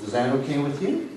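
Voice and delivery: ordinary delivery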